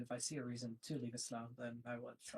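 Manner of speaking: soft, trailing away; too quiet to hear. Real, not acted. Guest answering why he stays in his faith, too quietly. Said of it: "Can you speak louder".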